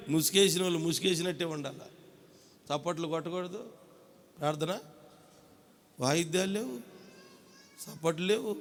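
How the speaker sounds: pin-drop quiet, mocking; mock hush. Mocking the silent church service.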